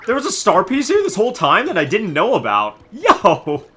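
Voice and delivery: loud, high delighted yells; excited shout. Real, not acted. Streamer to viewers, finding a hidden star piece, excited loud yell. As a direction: shout out at a surprise find.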